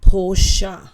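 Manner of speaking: Porsche as "POR-shuh"; two-syllable Porsche. Shown as a demonstration. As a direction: say Porsche with an added final "uh". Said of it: "it's actually pronounced".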